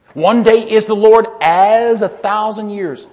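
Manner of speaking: loud, slow ringing first half; booming first half. Shown as a demonstration. Preacher quoting the half everyone knows, booming. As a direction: boom the familiar half out slowly. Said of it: "we only hear the first part".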